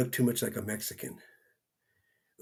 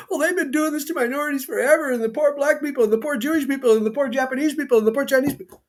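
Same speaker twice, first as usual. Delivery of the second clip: much higher, sing-song mocking whine; mocking whiny voice; 13 semitones higher, faster than usual. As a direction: parody the complainers in a high whine. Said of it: "people crying out there about".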